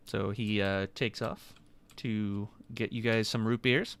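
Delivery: normal talking voice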